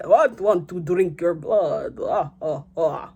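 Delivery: slow, hammed-up vampire count; Dracula voice. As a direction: drawl it out like a mock Dracula. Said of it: "he's like".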